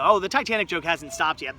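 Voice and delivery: usual speaking voice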